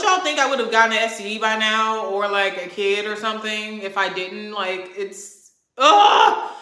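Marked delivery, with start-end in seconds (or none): 5.8-6.5 loud, high groan of frustration; exasperated groan. Real, not acted. Venting to viewers about a ruined weekend, loud exasperated groan. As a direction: let out a real, fed-up "ugh". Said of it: "I'm so mad".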